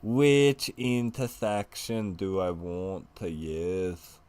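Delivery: put-on drunk Scotsman voice; slow, loud put-on Scottish accent; bad Scottish accent. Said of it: "Listen to me with this accent that's not very good".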